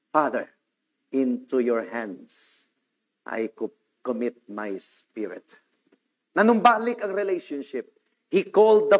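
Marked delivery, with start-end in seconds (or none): 0.1-5.6 slow, much softer and lower, fading out; dying whisper-soft prayer. Acted. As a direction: let the last words die away gently. Christ's last words voiced. (mahina at mabagal na huling panalangin)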